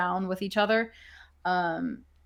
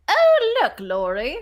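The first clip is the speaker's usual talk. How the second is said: smallest owl; 2 semitones higher, 5 dB louder, slower than usual. Acted. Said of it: "the smallest of the owls".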